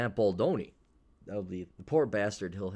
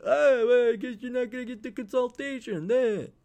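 movie quote voice; 7 dB louder than usual. Retold or imitated. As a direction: gloat like a stern shopkeeper. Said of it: "that quote from the movie".

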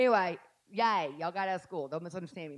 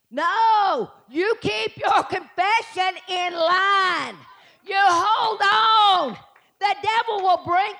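high, loud, slow rebuke; forceful preaching; 8 semitones higher, 7 dB louder, much slower than usual. Real, not acted. Preacher commands the congregation to hold fast, loud and slow. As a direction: shout it like a command.

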